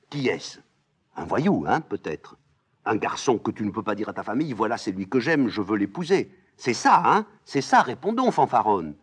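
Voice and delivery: put-on old nurse loudly teasing and scolding; mocking scolding nurse. Nurse demanding.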